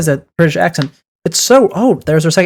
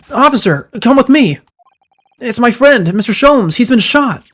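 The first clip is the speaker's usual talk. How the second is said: urgent plea; 7 semitones higher, slower than usual. Acted. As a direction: hurry the officer to a wounded friend.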